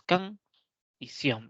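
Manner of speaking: canción split as can, pause, sion; syllables spelled out. Shown as a demonstration. Teacher to students showing the two syllables of canción, split apart. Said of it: "tiene dos emisiones de voz".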